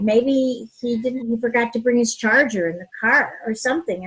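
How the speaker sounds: ordinary talk, nothing marked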